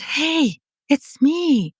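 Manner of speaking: acted eager hello; eager greeting. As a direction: call gently to someone who should know you. Getting her younger self's attention.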